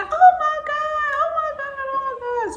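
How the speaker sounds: very high, loud excited squeal; overjoyed mom. Retold or imitated. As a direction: mom losing it with joy. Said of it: "She was like".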